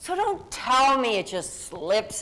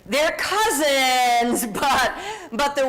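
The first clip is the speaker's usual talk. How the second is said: laughing; 3 semitones higher, 4 dB louder, slower than usual. Real, not acted. Laughing while comparing questions.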